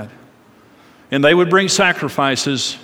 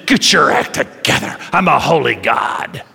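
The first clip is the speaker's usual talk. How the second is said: stern, lowered voice of a holy judge; God; 5 semitones lower than usual. Acted. God up there scolding struggling people, stern and low. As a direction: lay down the law in a low, weighty voice. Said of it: "they have this concept that God's up there saying".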